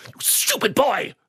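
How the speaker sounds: high, hammed-up outburst; mum's theatrical scolding. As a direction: imitate a mother's grand telling-off. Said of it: "so when she said it".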